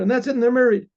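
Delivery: plain reading voice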